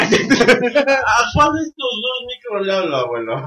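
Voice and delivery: slow, high mock-drunk voice; drunk dialing. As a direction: ham up being tipsy. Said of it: "este pinche micrófono ya me está viendo doble".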